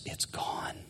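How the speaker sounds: soft whisper of relief; awed whisper. Retold or imitated. Prisoner telling the preacher his burden is gone, whispered. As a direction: let it slip out in wonder.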